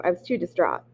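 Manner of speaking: ordinary delivery, nothing marked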